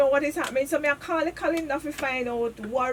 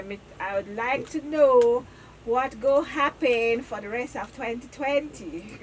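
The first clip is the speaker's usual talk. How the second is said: slowed down for the caller; slower repeat; 2 semitones lower, slower than usual. Retold or imitated. Caller repeating herself slowly for the lady. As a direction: spell it out slowly so she understands. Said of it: "Me say".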